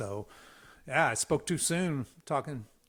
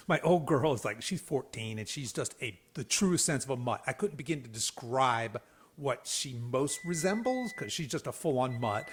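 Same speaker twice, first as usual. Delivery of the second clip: usual speaking voice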